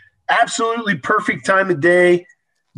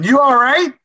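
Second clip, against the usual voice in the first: raised voice, calling across the road; calling out louder; 7 semitones higher than usual. Retold or imitated. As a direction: call out to him, louder. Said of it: "a little bit louder this time".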